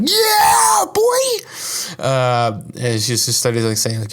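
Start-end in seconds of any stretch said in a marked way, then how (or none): 0.0-2.0 high, loud cry of victory; triumphant yell. Retold or imitated. Celebrating his imagined success, a loud falsetto whoop. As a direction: cheer like you just won. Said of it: "I'm like".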